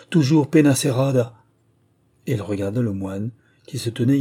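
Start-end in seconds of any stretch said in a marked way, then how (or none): 0.1-1.4 loud, higher-pitched exclamation of dread; horror-struck outburst; horrified cry. A narrator's reading. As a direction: cry out the haunting name in horror. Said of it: "se hérissaient d'horreur".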